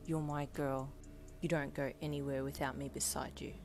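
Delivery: soft, low possessive rasp. A narrator's reading. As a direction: rasp it quietly, close to the ear. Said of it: "he rasped in your ear".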